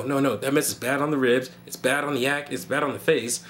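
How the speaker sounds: normal talking voice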